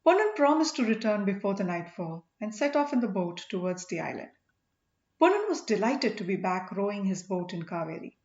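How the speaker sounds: usual speaking voice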